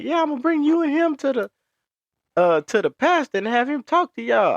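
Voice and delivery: put-on high old lady voice, pushed up. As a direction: mimic the lady threatening to call the pastor. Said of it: "She was like".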